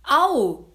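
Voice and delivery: sudden loud high cry of pain; jolted-awake yelp of pain; devil's yelp. Acted. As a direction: yelp as a hair is ripped out. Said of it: "schrie der Teufel".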